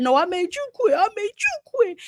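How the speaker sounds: imitating a high, upset fighter; mock emotional quote. Mimicking Shakur's upset cry of I made you quit. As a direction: pitch it way up like a rattled boxer. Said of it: "He just said".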